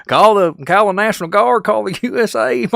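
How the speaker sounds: high, loud hammed-up Kentucky hillbilly voice, laughing through it; hillbilly local accent. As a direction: shout it like an excited Kentucky local. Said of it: "full-blown hillbilly".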